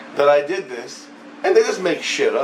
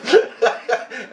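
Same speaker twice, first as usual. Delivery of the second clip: high burst of laughter; real laugh; 11 semitones higher than usual. Real, not acted. Storyteller amused by his own insult, laughing. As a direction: crack up at your own story.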